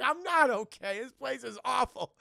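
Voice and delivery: loud, high-pitched complaint; mock distressed outburst; acted upset protest. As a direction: protest it with hammed-up misery. Acts R2 protesting to Luke.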